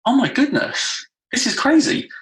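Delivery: mock disbelief at what he sees; shocked realisation. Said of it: "And I thought".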